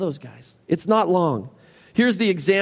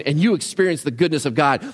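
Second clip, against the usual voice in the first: ordinary talk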